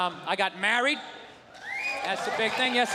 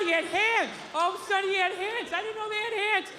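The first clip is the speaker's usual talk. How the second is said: put-on childish kid voice; 10 semitones higher than usual. Chiming into adult talk like an excited child. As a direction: blurt it out like an eager eight-year-old. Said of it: "I'd just be like".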